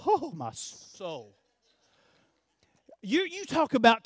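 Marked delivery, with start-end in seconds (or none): 0.1-1.4 soft, slow, low sigh of delight; savoring. Real, not acted. Pastor savoring the donut smell, soft and slow.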